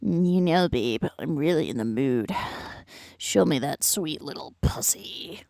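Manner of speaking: mock bedroom voice in a near whisper; mock dirty talk. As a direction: whisper it like a cheesy seducer. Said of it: "be like".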